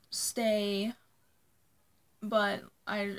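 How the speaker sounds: ordinary talk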